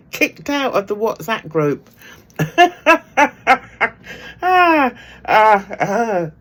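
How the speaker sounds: words broken up by loud laughter; laughing hard. Real, not acted. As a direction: laugh through the punchline, barely getting it out. Laughing through the punchline.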